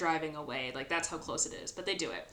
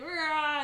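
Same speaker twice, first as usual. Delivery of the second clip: scare shout; 10 semitones higher, 6 dB louder than usual. Retold or imitated. Giles scares the fish man.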